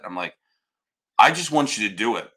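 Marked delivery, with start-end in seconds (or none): 1.2-2.3 put-on raised, louder insistence to the kids; firm, not yelling. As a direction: press the point hard without quite shouting. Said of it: "I wasn't yelling".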